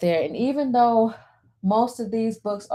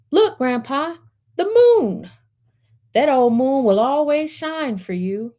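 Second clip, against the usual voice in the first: put-on higher, eager child voice pointing at the moon; little boy Max; 6 semitones higher, 4 dB louder than usual. Grandson Max shows Grandpa the moon, higher excited child voice. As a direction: play the small grandson, delighted. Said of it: "Max speaks to Grandpa".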